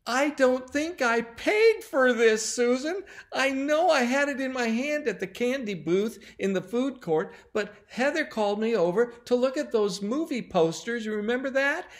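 put-on high, loud boy's wail, building; acted tearful confession. A narrator's reading. Timmy confessing to Susan about the candy, mock sobbing. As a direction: wail it like a distraught child, peaking on the confession. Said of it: "He sobbed and he sobbed".

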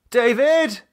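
high, urgent calling out for David; a woman shouting for her partner in alarm. Acted. Lisa calling for David through the empty flat, alarmed.

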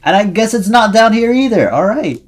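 high, loud exasperation; exasperated outburst. Real, not acted. Player not finding it down here, loud exasperated outburst. Search in game fails.

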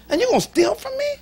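incredulous, hurt indignation; indignant outrage. Real, not acted. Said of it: "I was hotter than fish grease".

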